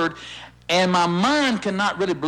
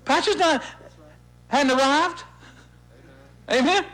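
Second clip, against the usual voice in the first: ordinary delivery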